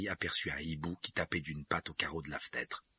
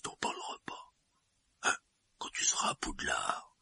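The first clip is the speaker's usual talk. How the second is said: whispering; 6 semitones lower than usual. A narrator's reading. Hagrid asks secrecy.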